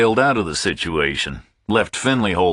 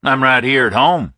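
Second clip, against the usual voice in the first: put-on higher, louder Southern American accent, relaxed; Southern accent, unbothered; a Southern caller reassures the phone company; easy southern caller; 8 semitones higher, 6 dB louder than usual. A narrator's reading. Hubble voiced on speakerphone.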